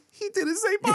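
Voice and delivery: put-on high complaining little kid; Veda. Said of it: "She goes".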